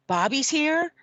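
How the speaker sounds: high, eager mock surprise; surprised Bill Murray. To the guest, Bill Murray surprised, high and eager. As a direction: perk right up at the name.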